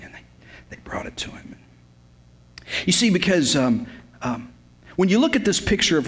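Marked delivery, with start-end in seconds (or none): 0.0-1.6 hushed whisper; awed whisper as the gifts are laid down. Acted. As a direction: breathe it out, barely a whisper. Hushed reverent moment in story.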